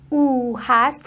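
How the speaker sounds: ordinary talk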